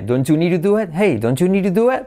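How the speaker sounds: "don't you need to do it" as donchu-niru-duet; linked words. Shown as a demonstration. Teacher models the joined question, linked and high. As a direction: run it together, IT like ET, pitched high. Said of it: "es una I como E".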